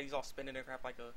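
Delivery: normal talking voice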